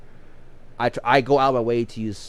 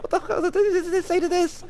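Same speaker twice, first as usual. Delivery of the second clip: much higher, strained voice of shocked disbelief; stunned disbelief. Retold or imitated. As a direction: squeak it out like you can't believe your ears. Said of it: "I'm like".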